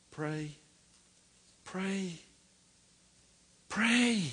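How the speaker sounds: preacher's rising triple command; building exhortation. Real, not acted. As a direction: build each pray into a cry.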